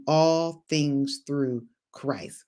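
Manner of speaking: very slow, each word weighted; preacher drawing out the scripture slowly; slow emphatic declaration. Shown as a demonstration. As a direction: draw out every word like a vow. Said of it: "declaring scripture".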